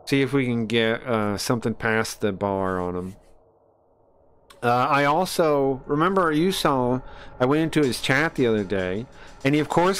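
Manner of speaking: usual speaking voice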